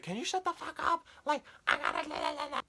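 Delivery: very high squeaky mock voice of an annoyed mom; mom snapping. Mom telling yelling family to shut up, squeaky mock voice. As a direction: parody mom telling everyone off. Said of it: "And she was like".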